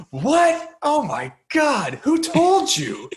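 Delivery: high, drawn-out mock outrage; mock shock. Acting out a dad shocked his son knows he's adopted, mock shock. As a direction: wail it in exaggerated, stretched-out disbelief. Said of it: "then Stanley Tucci goes".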